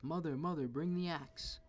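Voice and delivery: urgent yell while fleeing. A narrator's reading. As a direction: call down to mother in a panic.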